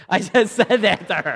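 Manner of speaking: says it through a real laugh; laughing at himself. Real, not acted. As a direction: laugh at your own boldness. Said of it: "I don't know why I said that".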